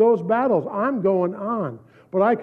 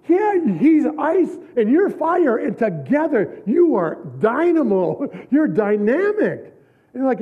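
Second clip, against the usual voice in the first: excited retelling; 7 semitones higher than usual. Retold or imitated.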